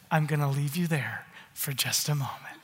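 a soft, hushed whisper; softly whispered aside. Acted. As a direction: lean in and whisper it.

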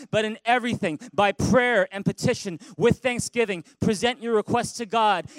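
loud, high congregational recital; big loud reading. Shown as a demonstration. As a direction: read it boldly together. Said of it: "Read it with me in a big, loud voice".

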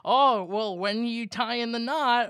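high, slow, nudging granny; cartoony grandma. Grandma nudging about marriage, cartoony high voice. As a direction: nudge them in a drawn-out grandma voice. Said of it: "cartoony grandma thing".

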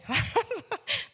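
bright rising laugh; a quick laugh at her own dream. Real, not acted. Laughing at her teenage dream.

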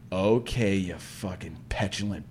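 low, very soft, contemptuous mutter; low muttered self-scolding. Retold or imitated. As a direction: mutter it down at yourself. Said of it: "That's what I said to myself".

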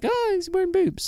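high-pitched imitation of a laughing kid; amused kid. Remembering himself as a kid watching this, high childish amusement. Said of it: "as a kid, I remember this".

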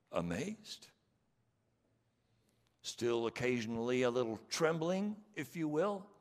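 rally the whole congregation; preaching at full force. Real, not acted. Preacher rallies the church before worship, rousing and loud.

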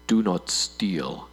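slow, low solemn voice of God; slow, low divine command. Acted. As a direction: intone it like God. God voiced in sermon skit.

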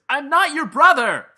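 loud passive-aggressive snap; sweetly passive aggressive. Retold or imitated. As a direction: shout it, sweet but seething. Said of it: "in a, a very, like, passive-aggressive way".

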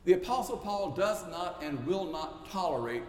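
ordinary talk, nothing marked

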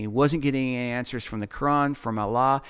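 normal talking voice

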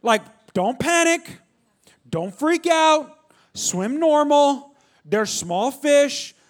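very slow, spaced-out instructions; slow, deliberate reminder to a panicker; emphatic coaching. Retold or imitated. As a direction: drum each reminder in, slowly and firmly. Voices guides' reminder, drawn out emphatically.

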